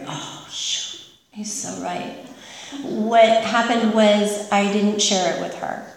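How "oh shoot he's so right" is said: breathy whisper, dropped right down; whispered realization. Retold or imitated. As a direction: whisper it to yourself as the penny drops. Inner thought realizing he's right.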